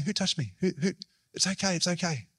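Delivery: gentle reassurance. Shown as a demonstration. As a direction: coax a frightened person gently. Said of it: "I reckon he was saying".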